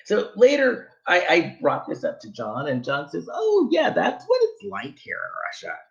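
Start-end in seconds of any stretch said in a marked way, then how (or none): 3.3-5.8 John's voice. Retold or imitated. Voicing John's casual reply.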